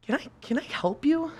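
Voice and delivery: a lightly voiced fed-up teacher; pointed offer.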